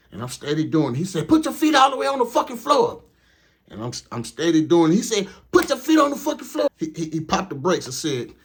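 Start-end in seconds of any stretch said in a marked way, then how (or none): 1.3-3.0 high, loud barked order; yelling trainer. Retold or imitated. Trainer yelling at the new driver to floor it, high loud bark. 5.5-6.7 high, loud barked order; yelling trainer. Retold or imitated. Trainer yelling at the new driver to floor it, high loud bark.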